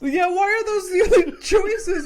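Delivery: high, loud yell breaking into laughter; laughing mock yell. Friend retells Jonah Hill's screaming, laughing. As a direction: yell it while cracking up.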